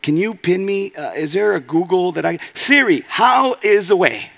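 high, bewildered Thomas asking his phone; confused Thomas. Retold or imitated. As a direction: play Thomas lost and asking Siri. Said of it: "And then Thomas".

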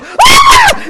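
very high, loud mock scream; panicked scream. Storyteller imitating his screaming friend, full-volume panicked shriek. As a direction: shriek like a terrified passenger. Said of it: "He's just screaming".

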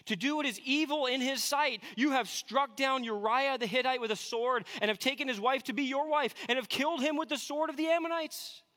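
high, insistent scriptural indictment; accusing divine voice. Acted. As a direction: press the charges home as God accusing. Reads God's accusation against David.

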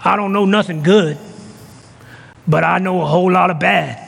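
low-pitched imitation of an old lady. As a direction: drop the pitch, play an elderly woman. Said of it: "that's my old lady voice".

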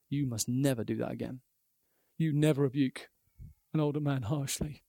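quiet fatherly rebuke, no shouting; a father quietly setting his son straight; fatherly firmness. Retold or imitated. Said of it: "with a fatherly firmness".

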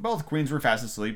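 ordinary delivery, nothing marked

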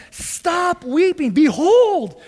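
lightly acted elder, calling out commandingly; elder's command. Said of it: "One of the elders said to me".

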